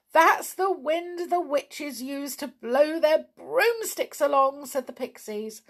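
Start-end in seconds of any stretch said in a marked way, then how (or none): 0.1-4.7 high, urgent pixie voice; excited, high-pitched alarm; pixie warning. A narrator's reading. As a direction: warn in a raised little-creature voice. Pixies warn of witches coming.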